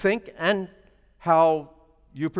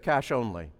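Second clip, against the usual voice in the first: low, flat imitation of the clerk; curt clerk; 8 semitones lower, 7 dB quieter than usual. Preacher quoting the pound clerk, low and deadpan.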